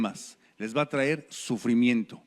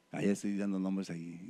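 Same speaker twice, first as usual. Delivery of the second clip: normal talking voice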